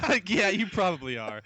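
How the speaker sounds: words breaking into laughter; amused, laughing agreement. Real, not acted. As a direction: say it through a real laugh. Laughing at co-host's dig.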